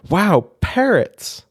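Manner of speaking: high, slow delighted exclaim; light up at the parrots; excited wow. Acted. Jack seeing parrots in the aviary, drawn-out excited wow.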